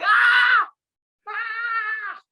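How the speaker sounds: high shrieking baby cry, mimicked; baby yelling imitation. Dad imitating his baby son yelling during sparring, high shriek. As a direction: squeal like a baby boy in the background. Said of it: "and he's like".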